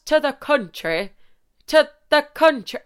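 retrying a rural Irish accent, still barely there; failed Irish country accent. Shown as a demonstration. Annoyed she can't manage the accent, weak Irish country accent. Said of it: "why can't I do a country accent?".

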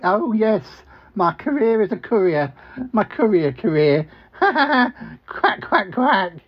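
high quacking duck voice; talking duck. Acted. Talking duck greeting the couple at the door, high and quacky. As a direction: pitch it up and quack like a cartoon duck. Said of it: "Ozzy, the talking duck".

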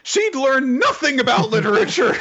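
high, exaggerated indignant complaint; hammed-up high-pitched grievance; mock character outburst.

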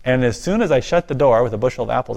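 ordinary talk, nothing marked

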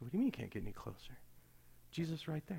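soft, quick questioning; hushed retold question. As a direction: ask it quickly under your voice. Retold puzzled reply, hushed.

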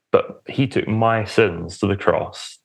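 normal talking voice

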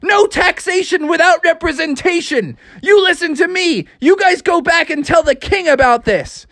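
very high, hammed-up indignation; outraged protest. A narrator's reading. Frog telling off British tea ship crew, high and outraged. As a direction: lecture them in a high, fired-up voice.

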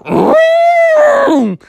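very high, loud squealing roar; bull roar. Acted. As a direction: bellow like a struck bull. Bull reacts to being whacked.

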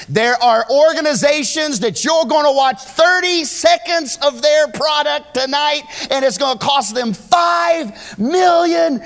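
high, loud, slow preaching; preaching at full force. Real, not acted. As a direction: pour it out like a revival preacher.

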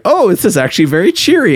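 quick, high-pitched reaction; lightly acted delighted discovery; mock pleasant surprise. Acted first reaction to game.

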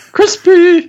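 piercing, very high falsetto; Mortal Kombat pop-up guy. Retold or imitated. Co-host asks to do it again, loud falsetto impression. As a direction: shout it as a squeaky game catchphrase. Repeats the game catchphrase.

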